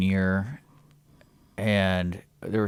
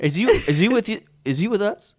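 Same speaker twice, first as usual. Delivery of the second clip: pitched way up, puzzled mock knight asking; high confused knight; 11 semitones higher than usual. Friend mimics a baffled knight, pitched way up. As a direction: play a bewildered fake knight, voice raised high.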